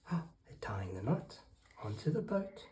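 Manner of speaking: hushed, whispered commentary; hushed aside. Real, not acted. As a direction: whisper it as the suspense builds. Hushed aside during story reading.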